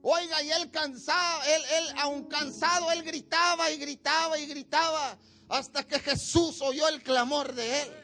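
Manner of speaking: ordinary talk, nothing marked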